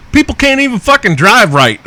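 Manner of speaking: ranting about people who can't drive; exasperated rant. Real, not acted. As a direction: blow up at bad drivers.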